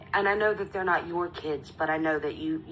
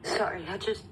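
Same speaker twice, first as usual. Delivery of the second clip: holding back tears; 3 semitones higher than usual. Real, not acted. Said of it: "sorry, I'm trying not to cry".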